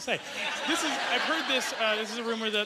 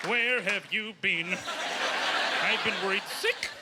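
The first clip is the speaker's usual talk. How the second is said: scolding parent God; 2 semitones lower than usual. Acted. God's letter read as a worried parent. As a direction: nag like a worried mother. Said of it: "a letter that says".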